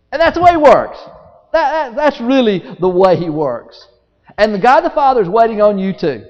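high, loud, driving sermon; full-force preaching. Real, not acted.

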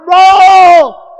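loud, high, drawn-out holler; hollered name. Retold or imitated. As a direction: holler for your brother to save you. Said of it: "And I hollered".

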